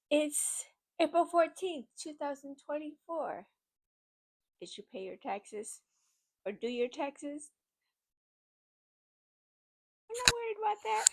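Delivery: bubbling over with giddiness; giddy. Real, not acted. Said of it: "Sorry, I'm just too giddy".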